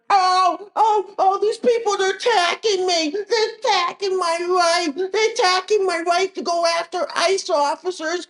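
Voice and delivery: high, whiny fake sobbing protester; hammed-up crybaby whining about being attacked; mock crying leftist. Mocking leftist protesters crying that they are attacked, high whiny fake sobs. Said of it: "I don't want these leftists crying, complaining".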